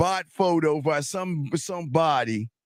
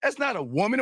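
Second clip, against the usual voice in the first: normal talking voice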